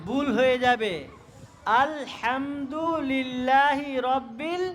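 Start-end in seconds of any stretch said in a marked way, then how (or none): 1.8-4.6 measured, drawn-out "আলহামদুলিল্লাহি রব্বিল"; higher, slow teaching recital; slow recitation. Shown as a demonstration. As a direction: recite slowly, syllable by syllable, for learners. Slowly models a Quran verse.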